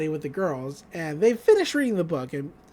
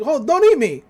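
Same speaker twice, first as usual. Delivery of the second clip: high, louder mock plea; hammed-up panicked begging, pitched up; acted pleading; 6 semitones higher, 4 dB louder than usual. Zeus begs McGucket not to eat him, mock pleading. Acting a pig pleading for life.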